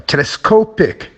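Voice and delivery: telescopic with SCOP stressed; tele-SCOP-ic, as a stress demo; stress in the middle. Shown as a demonstration. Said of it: "it's in the middle".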